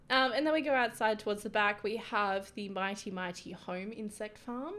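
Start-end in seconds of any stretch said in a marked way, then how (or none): none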